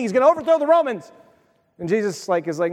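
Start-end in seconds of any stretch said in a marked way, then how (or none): 0.0-1.1 high, fast burst of excitement; imitated breathless anticipation, rushed and high; excited hope. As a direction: act out eager followers getting carried away. Acting out followers' excited hopes.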